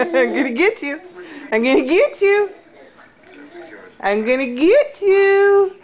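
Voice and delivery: ordinary delivery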